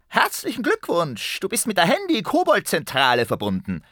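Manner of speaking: very high goblin voice; voz de duende. A narrator's reading. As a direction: squeak it like a cheery goblin switchboard operator. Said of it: "meldete sich eine fremde Stimme".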